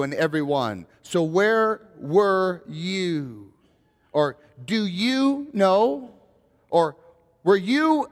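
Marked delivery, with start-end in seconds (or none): 1.0-3.5 So where were you? very slow, leaning on "you"; stress on you. Shown as a demonstration. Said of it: "you can emphasize the word "you". 4.1-6.1 Or do you know? slow, higher, weight on "you"; stress on you. Shown as a demonstration. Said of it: "you can emphasize the word "you".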